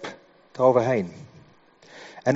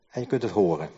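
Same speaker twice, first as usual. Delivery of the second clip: lower, softer, falling to a close; pointedly understated; 4 semitones lower, 6 dB quieter, faster than usual. Shown as a demonstration. Preacher hinting at deeper hearing to the congregation, pointedly understated. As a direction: drop it low and quiet, letting it sink in. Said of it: "Als je snapt wat ik bedoel".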